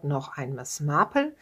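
normal talking voice

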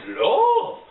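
sudden high squeal on love. Acted. Dorian squealing love at Henry.

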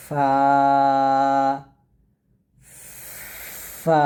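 ফা with a long blown f and held vowel, twice; airy Arabic fa. Shown as a demonstration. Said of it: "ফাট উচ্চারণ করবে এরকম". (নরম করে বাতাসসহ টানা ফা)

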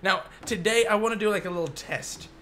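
usual speaking voice

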